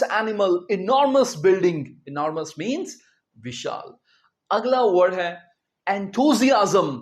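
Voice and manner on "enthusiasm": enthusiasm drawn out very slowly, syllable by syllable; teacher modelling enthusiasm slowly; slow model word. Shown as a demonstration. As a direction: stretch enthusiasm out for students to copy. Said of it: "क्या कहेंगे?".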